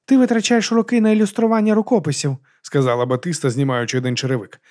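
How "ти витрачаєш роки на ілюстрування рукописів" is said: high-pitched needling voice; lifted, mocking character voice; teasing Batista. A narrator's reading. Batista teases the monk.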